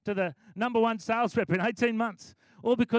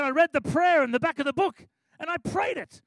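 eager report; 7 semitones higher, 6 dB louder than usual. Retold or imitated. Ashley proudly reporting his prayer.

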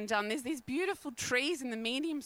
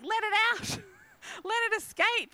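fervent urging; 9 semitones higher, 4 dB louder than usual. Real, not acted. Urging passionate release.

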